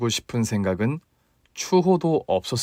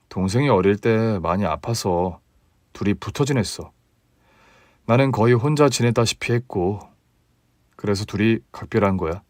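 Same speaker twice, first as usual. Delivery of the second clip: lower husband voice; 3 semitones lower than usual. Acted. Husband explaining.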